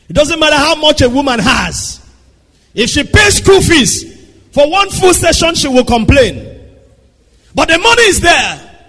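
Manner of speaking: 높고 크게 외치는 설교; full-force preaching. Acted. Preacher presses point on wives.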